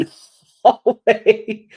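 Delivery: word bursts out in laughter; laughing. Real, not acted. Laughing at approaching footsteps.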